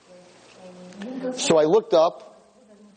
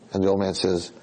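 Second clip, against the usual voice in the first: normal talking voice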